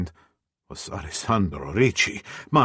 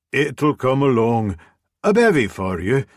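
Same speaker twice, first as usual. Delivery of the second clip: brisk Scottish landlady, higher and louder; bustling Scottish barkeep offering a drink; Scottish brogue; 5 semitones higher, 5 dB louder than usual. Acted. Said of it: "her thick Scottish brogue".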